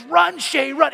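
high, loud urging yell; retold shout. Teammate urging Shay to run, loud yell. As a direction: call him on to run. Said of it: "the kid steps back and goes".

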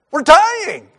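high, loud frightened shout; panicked cry. Retold or imitated. Disciples shouting to the woken Jesus, high frightened cry. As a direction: blurt it out in terror.

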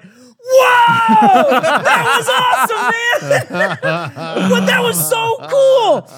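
high, loud mock cheering; hyped-up celebration. Friends cheering Gavin's pickup line by the pool, hyped-up shouting. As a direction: whoop it up like an amazed buddy.

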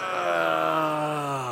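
long, drawn-out pained groan; sustained cry-like groan. Shown as a demonstration. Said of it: "hear the sound of groaning".

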